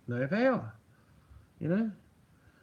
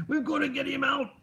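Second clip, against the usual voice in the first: high, angry mock-Scottish accent; hammed-up angry Scotsman, pitched well up; Willie from The Simpsons; 9 semitones higher than usual. Streamer demonstrating angry Scottish inflection for Robbo, hammed-up cartoon Scotsman. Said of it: "sound angry if you're a Scotsman like Willie".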